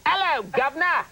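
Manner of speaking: hammed-up Cockney, pitched high; bad British accent. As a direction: overdo a terrible British accent. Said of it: "Do a British accent".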